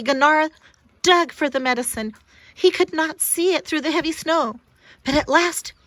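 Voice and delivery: ordinary delivery, nothing marked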